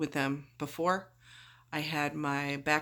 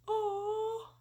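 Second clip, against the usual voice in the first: aw; 3 dB quieter than usual. Real, not acted. Melting at kids learning French.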